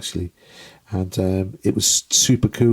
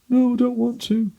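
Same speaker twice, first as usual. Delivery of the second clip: mock reluctant whine; 12 semitones higher than usual. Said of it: "I'd be going".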